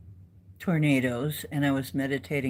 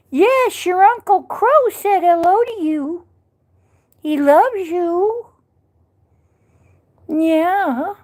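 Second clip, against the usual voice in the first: much higher, cooing voice to a pet; 14 semitones higher, 8 dB louder than usual. Real, not acted. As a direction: coo up high at the little cat. Cooing to the cat on stream.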